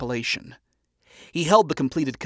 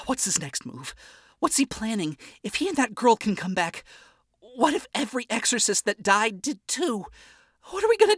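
frantic rambling; 9 semitones higher than usual. A narrator's reading. Charlie panicking alone over the board, frantic. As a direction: pitch climbing higher into panic.

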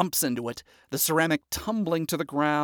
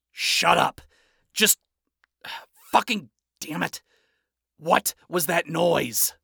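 loud, cursing snap; furious yell after falling; angry outburst; 3 semitones higher, 4 dB louder, slower than usual. Acted. Adam angry after fall.

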